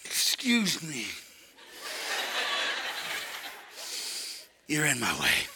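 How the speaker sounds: very soft, slow, low reply; grudging toddler. Retold or imitated. Preacher plays grandson repeating mom's words, soft and grudging. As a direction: imitate a child reluctantly parroting polite words.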